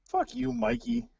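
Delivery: mock-outraged high squeak; high squeaky outburst.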